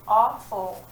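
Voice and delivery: awful with the aw loud and drawn; teacher models awful; aw sound stressed. Shown as a demonstration. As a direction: lean on the aw in awful. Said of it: "How about".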